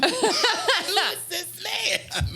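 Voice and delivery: very high, loud, stretched-out squeal; hammed-up drunk disbelief. Drunk singer baffled by a stranger, loud drawn-out mock squeal. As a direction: draw each word out in laughing mock astonishment. Repeats reaction, much bigger.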